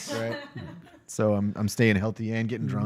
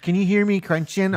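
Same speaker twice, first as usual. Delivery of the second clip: high-pitched question; 10 semitones higher than usual. Retold or imitated. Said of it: "She even asked".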